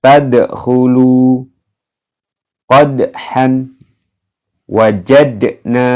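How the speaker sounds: slow recited words, bounced d; Quranic recitation. Shown as a demonstration. Teacher demonstrating qalqalah dal examples. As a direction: recite slowly, bounce each d.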